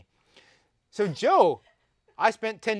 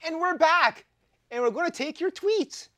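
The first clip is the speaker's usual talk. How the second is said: bright, loud show-host comeback; host announcer; 11 semitones higher, 6 dB louder than usual. Acted. Back from break, host announcing.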